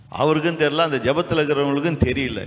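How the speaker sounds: ordinary delivery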